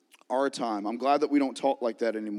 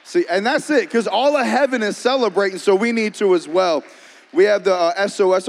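higher, louder burst of excitement; fired-up preaching; 6 semitones higher, 6 dB louder than usual. Real, not acted. Pastor rallying the church to celebrate with heaven, fired-up preaching. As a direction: preach it loud and urgent.

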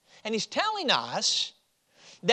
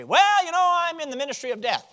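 higher, brighter mock answer, tossed off quickly; mock cheerful reply; 7 semitones higher, 4 dB louder than usual. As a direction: play the proud minister of death for a laugh.

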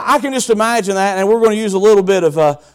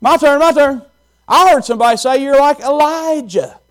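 higher, eager voice; eager disciple; 6 semitones higher, 3 dB louder than usual. Acted. A disciple blurting to Jesus, mildly eager. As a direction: jump in like an eager student. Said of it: "somebody else would go".

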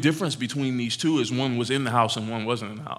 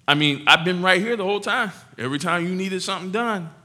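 put-on loud, high, hurt older-brother whine; the older son's outraged where's-mine; aggrieved complaint; 3 semitones higher, 5 dB louder than usual. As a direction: throw the jealousy at daddy. Older brother protesting to father.

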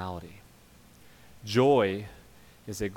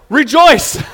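"Rejoice" shouted loud and high as a mock command; 20 dB louder than usual. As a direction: bark "Rejoice" like an overdone order. Shouted end of mock plea.

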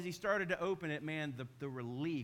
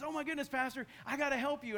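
higher, louder startled neighbor; alarmed neighbor; 10 semitones higher, 4 dB louder than usual. Retold or imitated. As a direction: gasp it out like a shocked neighbor. Neighbor voiced in story.

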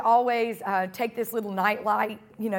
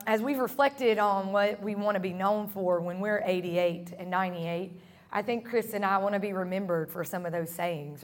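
ordinary talk, nothing marked

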